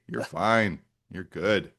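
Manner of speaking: usual speaking voice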